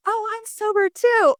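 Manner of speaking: high, chummy retold exclamation; eager bartender. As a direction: imitate a bartender piping up eagerly. Retold bartenders eagerly sharing they're sober.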